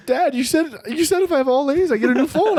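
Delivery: high, whiny mock-crying plea; hammed-up sobby protest; imitating crying kid. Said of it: "and I started crying".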